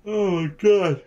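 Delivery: talking through a yawn; stretched yawning voice. Real, not acted. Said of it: "I can't stop yawning now".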